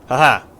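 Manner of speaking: loud gloating haha; loud triumphant laugh. Real, not acted. Gloating after boss dies.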